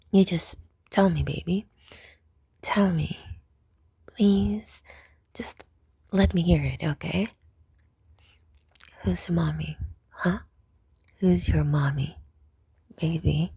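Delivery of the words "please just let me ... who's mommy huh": normal talking voice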